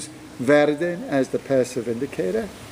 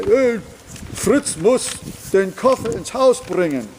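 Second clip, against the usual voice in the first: higher, louder mock order; mock wrong version; 8 semitones higher, 3 dB louder than usual. Teacher mimics a speaker naming who carries the suitcase, higher and louder. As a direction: boss someone around with the wrong sentence. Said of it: "So you don't say".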